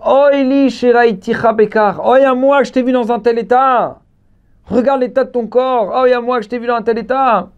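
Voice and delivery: hammed-up preacher's lamentation; acted lament. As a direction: cry out in dismay at the sight. Acted lament of weeping Rabbi Pinchas.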